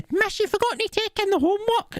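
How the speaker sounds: very high, squeaky mocking mimicry; mock tattletale pupil. Wee pupil reminding the teacher, mocking squeaky voice. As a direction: imitate an insufferable pupil in squealing falsetto. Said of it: "that wee arsehole just went".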